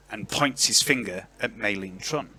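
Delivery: normal talking voice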